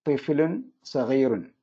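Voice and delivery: each word set out slowly, തിഫ്ലുൻ weighted; unhurried demonstration for students; slow model answer. Shown as a demonstration. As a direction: dictate it slowly to the class. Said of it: "ലഹ എന്നത് ഉപയോഗിച്ചു".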